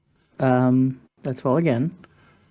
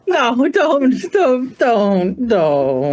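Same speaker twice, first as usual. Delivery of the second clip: pleading no; 8 semitones higher, much slower than usual. Real, not acted. Player begging Talon not to.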